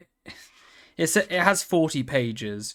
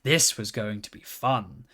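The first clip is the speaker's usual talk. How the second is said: lively storybook narration; reading to children; 3 semitones higher than usual. Acted. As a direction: read the pony story like a bedtime book. Reads children's book aloud.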